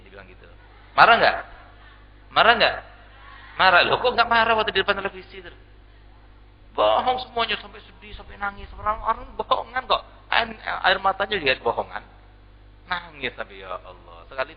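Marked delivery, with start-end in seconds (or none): 1.0-3.8 loud challenge. Real, not acted. Preacher challenges congregation loudly. 3.9-12.0 mocking rebuke. Preacher scolds TV crying.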